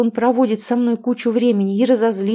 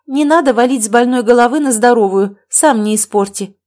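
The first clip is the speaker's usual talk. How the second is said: much louder, indignant comeback; heated retort; 4 semitones higher, 7 dB louder than usual. A narrator's reading. Mother turns blame back on son, heated retort. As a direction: throw the blame back loudly.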